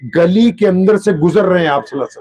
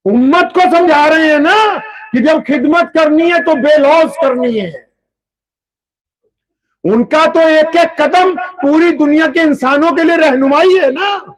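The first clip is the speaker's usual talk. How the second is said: raised, shouting sermon voice; fiery preaching; 8 semitones higher than usual. Acted. Preacher urges the congregation to serve selflessly, shouting. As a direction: drive the lesson home to the crowd.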